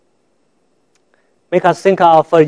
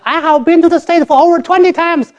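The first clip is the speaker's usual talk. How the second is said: much higher, a put-on pleading woman; high mock lady voice; 11 semitones higher than usual. Lady at the embassy insisting to the officer, high mock voice. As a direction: squeak it out like the indignant applicant.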